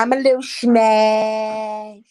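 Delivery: slow, drowsy dog voice; voicing the dog. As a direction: speak for the dog, slowly. Voicing dog, slow, odd timbre.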